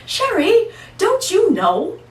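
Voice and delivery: as if the answer were obvious; wife's astonished voice; incredulous. Retold or imitated. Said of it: "looked at me like I was nuts".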